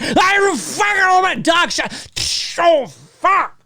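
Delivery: high frantic shouting swears; hammed-up high panicked cursing; manic skit voice. Continuing manic Loomis skit.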